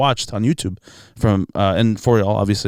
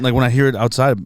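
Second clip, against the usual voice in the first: ordinary delivery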